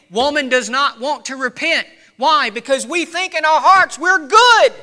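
emphatic sermon delivery; impassioned preaching. Real, not acted. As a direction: preach it forcefully, building to emphatic peaks. Preaches at full force.

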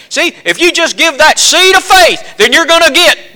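put-on high, loud Southern prosperity-preacher accent; hammed-up Southern TV preacher accent. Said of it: "notice my southern accent just kicked in".

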